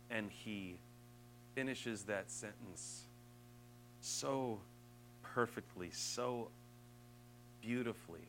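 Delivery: lightly hushed awe; hushed reverent preaching. Acted. Reverent turn to Jesus.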